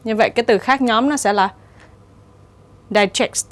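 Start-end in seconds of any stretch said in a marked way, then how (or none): 2.9-3.5 "digest" with its final t pronounced; sounded t. Shown as a demonstration. Teacher showing the odd word out, sounded t. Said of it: "nó phát âm là âm t".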